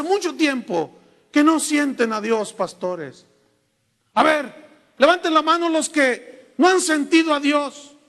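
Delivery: building to a loud pulpit call; preacher's altar call. Retold or imitated. Preacher rallying his pastors, loud and climbing.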